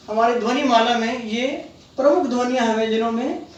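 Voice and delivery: usual speaking voice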